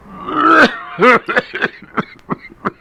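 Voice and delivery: loud, drawn-out hacking cough; coughing fit that blocks any reply; long coughing fit. Acted. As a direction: choke on a cough that won't stop. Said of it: "found it impossible to reply".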